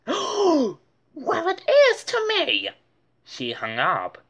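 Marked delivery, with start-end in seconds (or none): 0.1-2.7 very high, louder hurt retort; indignant. Acted. Francine retorting angrily to Muffy. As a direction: fire it back offended.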